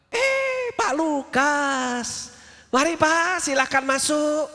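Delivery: imitating a wife gone suddenly gentle, high and slow; sudden sweet voice. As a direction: switch to honeyed politeness at the door. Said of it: "Kok tiba-tiba jadi lembut?".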